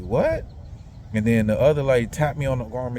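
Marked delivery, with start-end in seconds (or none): 0.0-0.5 higher startled what; man shocked by a flirty remark, high startled what. Retold or imitated. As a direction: react startled. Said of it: "And I looked like".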